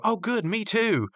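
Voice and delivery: high, drawn-out mock delight; Eileen. Eileen to silent Rigby, high imitated delight. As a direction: imitate an oblivious, eager admirer.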